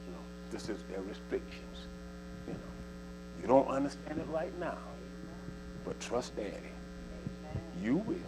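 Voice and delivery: calm a son down softly; soft, low fatherly reassurance; gentle father. Acted.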